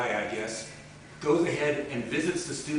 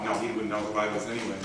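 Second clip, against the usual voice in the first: ordinary talk, nothing marked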